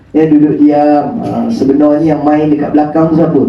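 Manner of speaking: normal talking voice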